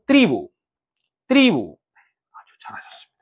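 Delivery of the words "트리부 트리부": 트리부 with the stress on 트리. Shown as a demonstration. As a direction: hit the i of 트리부, let 부 drop. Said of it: "이에 강세가 있겠네요".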